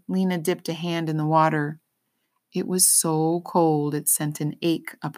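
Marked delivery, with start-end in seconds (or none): none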